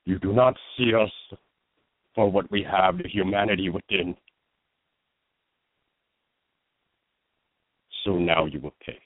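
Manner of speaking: orc villain, a hint of menace; bitter threat building to vengeance; Scorch voice. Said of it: "Scorch would speak to you a little bit more like this".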